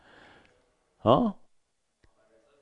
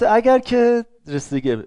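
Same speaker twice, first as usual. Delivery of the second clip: normal talking voice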